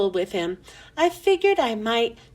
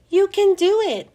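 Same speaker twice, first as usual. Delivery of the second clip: squeaky encouragement; 8 semitones higher, 4 dB louder than usual. A narrator's reading. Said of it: "I squeaked".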